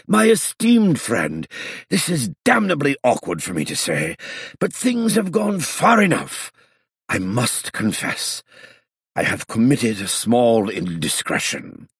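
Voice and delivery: put-on high, slow, fretful old man; a flustered elderly aristocrat confessing; fussy old gentleman. A narrator's reading. Voices old Du Barnstoker.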